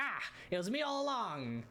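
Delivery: high, triumphant reveal cry; dramatic reveal. Acted. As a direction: shout the big reveal. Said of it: "He's like".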